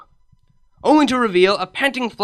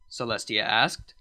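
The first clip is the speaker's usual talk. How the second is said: ordinary delivery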